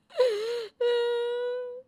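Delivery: high, loud wailing sob; wife sobbing falsely to turn her husband; fake sobbing. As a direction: burst into a put-on sob. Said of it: "बीवी के झूठे आंसू".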